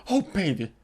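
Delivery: soft, low seductive coo; sultry murmur. Retold or imitated. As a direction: coax him into bed, softly. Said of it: "And she goes".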